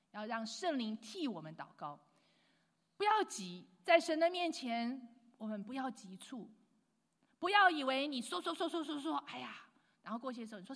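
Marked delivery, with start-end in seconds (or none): none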